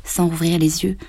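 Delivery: plain reading voice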